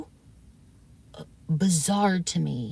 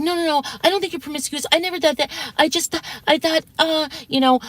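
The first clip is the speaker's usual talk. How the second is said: high, fast mock denial; mocking his backpedal; 8 semitones higher than usual. Retelling his second denial to viewers, high mocking voice. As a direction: rattle it off like a flustered man. Mimicking him backpedaling again.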